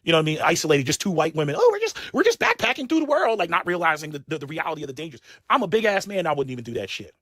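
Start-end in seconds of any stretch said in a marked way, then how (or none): 1.6-3.4 much higher, breezy mock voice; airy sing-song tourist voice; mocking naive traveller. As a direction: parody a carefree backpacker. Mocking naive backpacking women.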